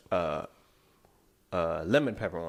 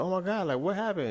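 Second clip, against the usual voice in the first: high, surprised friend voice; lightly voiced alarmed friend; retold shocked friend; 11 semitones higher than usual. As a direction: mimic her alarmed reply.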